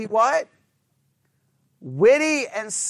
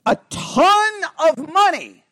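high, drawn-out "ton"; exaggerated; 6 semitones higher, 4 dB louder than usual. Shown as a demonstration. Teacher giving an example of hyperbole, "ton" exaggerated. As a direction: stretch "ton" high for overstatement. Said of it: "the example they use of hyperbole".

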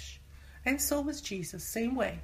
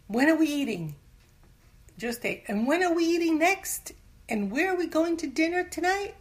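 mock-impatient, higher and louder; demanding body in charge; 8 semitones higher, 7 dB louder than usual. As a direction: play a body clamouring for its next meal. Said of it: "just put our body in charge".

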